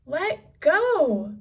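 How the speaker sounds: high and loud; shout. A narrator's reading. Annie shouting into the pirate's face, high yell. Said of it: "Annie shouted into his face".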